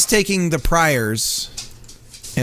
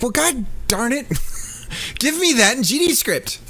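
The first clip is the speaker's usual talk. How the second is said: mock frustration; 8 semitones higher than usual. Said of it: "it's like".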